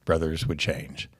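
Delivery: put-on low, quiet, solemn old author; Norman McLean voice. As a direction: read it gravely, fading to a whisper. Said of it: "as we think Norman McLean would sound".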